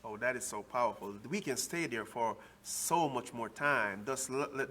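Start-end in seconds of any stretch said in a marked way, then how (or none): none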